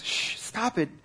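lion hushing the panicking man; hushed shush. Retold or imitated. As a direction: quietly shush him so no one hears. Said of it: "leans over and whispers".

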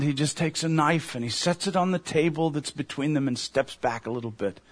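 ordinary talk, nothing marked

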